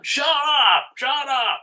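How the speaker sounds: high, mocking shout of shut up; imitated yelling owner. Neighbor yelling at his dog, imitated high and loud. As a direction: mimic a cruel owner snapping at his dog. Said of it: "like, yelling".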